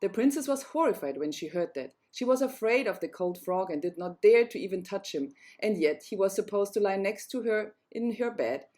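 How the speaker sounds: plain reading voice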